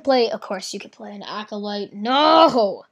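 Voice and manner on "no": loud, drawn-out cry of dismay; dismayed shout. Real, not acted. Player realizes the opponent's threat, dismayed shout. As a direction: wail it out in protest.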